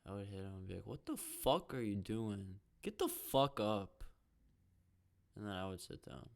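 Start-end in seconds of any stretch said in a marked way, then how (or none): 0.9-4.0 slow, louder mock confrontation; acted angry yelling. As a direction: lean hard on the swearing, hammed-up anger. Acting out yelling at a fan.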